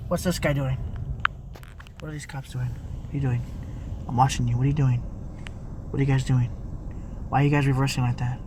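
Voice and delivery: low, subdued talking to oneself; muttered aside. Real, not acted. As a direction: mutter suspiciously while watching someone. Muttering aside while watching cops.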